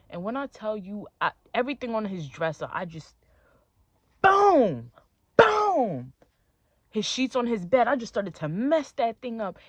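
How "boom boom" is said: two loud, high booms falling in pitch; imitated crash. Girlfriend sweeping his dresser clear, loud imitated crashes. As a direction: punch out each boom like things being smashed.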